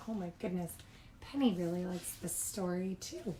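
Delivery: soft aside to listeners; quiet aside. Real, not acted. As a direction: murmur an aside. Aside about the dog.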